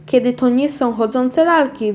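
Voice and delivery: plain reading voice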